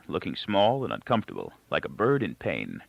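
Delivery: ordinary delivery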